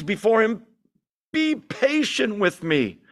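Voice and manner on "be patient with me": high, slow plea for patience; begging. A narrator's reading. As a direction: plead slowly, a servant on his knees. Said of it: "he begged".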